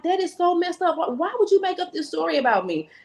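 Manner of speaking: high-pitched imitation of upset pleading; retold tearful protest. Bride confronting mother-in-law over made-up story, mildly tearful. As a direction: protest as if hurt, without real sobs. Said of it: "I'm just bawling, crying".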